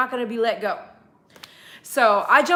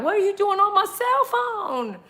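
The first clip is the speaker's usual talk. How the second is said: high scolding; 8 semitones higher than usual. Retold or imitated. Scolding dog.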